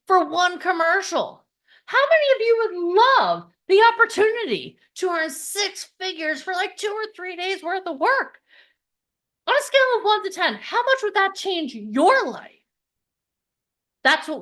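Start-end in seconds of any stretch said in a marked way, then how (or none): 1.9-12.5 rallying pitch. Real, not acted. Rallying the audience.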